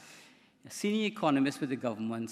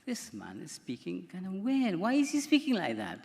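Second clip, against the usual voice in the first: higher, climbing, anxious wife's voice; a fretting wife pressing the doctor, voice rising; wife complaining to the doctor, rising higher; worried wife; 5 semitones higher than usual. Retold or imitated. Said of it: "and she said to me".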